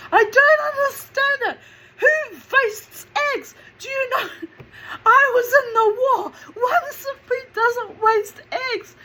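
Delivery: high, loud, laughing through it, in a put-on mum voice; mum's indignant outburst. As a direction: rant about the waste like a wartime mother.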